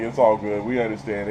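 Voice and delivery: low, quiet easygoing cop; lightly voiced calm cop. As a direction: drop low and soften for the cop. Cop reassures calmly.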